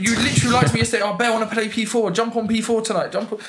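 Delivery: lightly mimicking a friend, fast and high; mock his excuses in a sing-song; london roadman accent. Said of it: "you're like".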